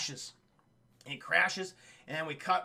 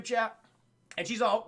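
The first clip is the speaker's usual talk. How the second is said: ordinary talk, nothing marked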